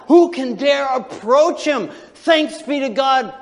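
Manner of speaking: high, loud, slow preacher's proclamation; soaring sermon cry; preaching at full force. Acted. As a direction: lift it up to the rafters.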